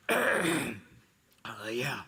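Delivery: rough, low, half-asleep gravelly voice. Retold or imitated. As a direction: croak it out like you just woke. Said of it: "I'll answer it in my gravelly voice".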